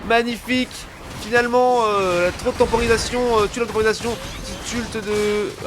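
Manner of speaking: high, loud excited play call; excited shout. Real, not acted. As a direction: erupt over a brilliant play. Big play, caster erupts.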